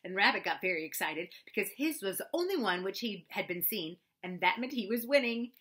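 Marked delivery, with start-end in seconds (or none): none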